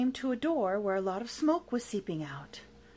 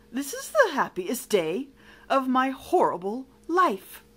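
louder villain voice, overcome; tearful joy; 3 semitones higher, 6 dB louder than usual. Acted. Villain gaining a first friend, tearful joy. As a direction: declare it, moved to tears. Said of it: "a great big shiny tear".

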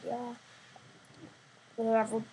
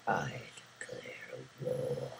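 zombie voice; 8 dB quieter than usual. A narrator's reading. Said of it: "shouted at the top of their lungs in their zombie voice".

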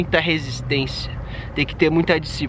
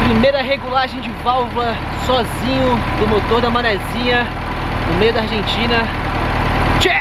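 higher, proud and upbeat; proud announcement; 8 semitones higher than usual. Real, not acted. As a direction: announce it like a small victory.

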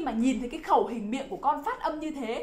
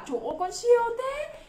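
high, rising gush of pretend amazement; amazed praise; 10 semitones higher than usual. Teacher praising a child's right answer, amazed praise.

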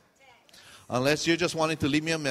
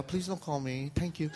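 soft, polite private plea; under the voice, as a secret wish; hidden thought; 8 dB quieter than usual. Acted. Hidden thought before fake invitation.

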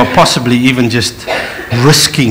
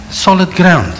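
ordinary talk

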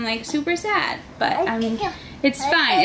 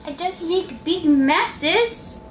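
put-on higher-pitched playful kid voice; speaking for the child; 7 semitones higher, slower than usual. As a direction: answer for a little one in a singsong lilt.

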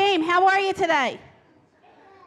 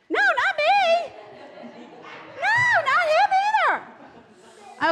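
very high, drawn-out protest; high-pitched, stretched-out mock disbelief; playful denial; 13 semitones higher, much slower than usual. Real, not acted. Playfully corrects children's wrong guesses.

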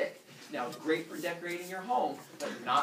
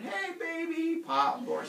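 high casual greeting; 14 semitones higher, 4 dB louder, slower than usual. Pretend. Kronos casual eating.

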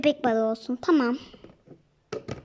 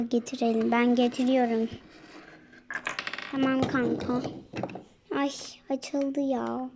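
usual speaking voice

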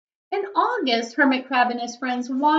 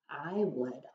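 much softer and lower, gently offered; shy offer; 7 semitones lower, 11 dB quieter than usual. A narrator's reading. Snail offering to help the crab, softly. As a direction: offer it small and soft like a snail. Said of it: "offered one of the snails".

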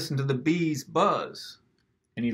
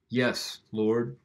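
usual speaking voice

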